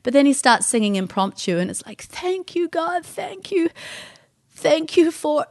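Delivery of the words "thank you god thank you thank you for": high, halting prayer of thanks; imitating a weeping man thanking God; tearful prayer. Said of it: "And he just wept".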